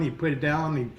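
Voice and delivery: ordinary delivery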